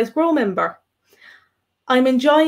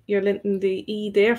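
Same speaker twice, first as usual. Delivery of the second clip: usual speaking voice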